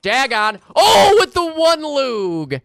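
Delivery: high drawn-out hype yell; excited shout. Real, not acted. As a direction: stretch it out in a thrilled scream. Caster hyping a kill.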